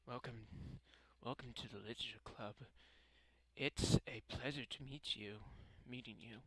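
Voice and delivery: hushed, shy-sounding welcome; quiet, gentle game character; soft-spoken girl. Acted. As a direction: greet them very softly.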